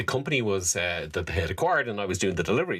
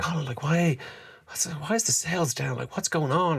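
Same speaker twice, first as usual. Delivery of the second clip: much higher, pressing boss demanding answers; imitating the boss; 9 semitones higher than usual. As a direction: put on the worried boss voice, up high. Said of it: "she'd say".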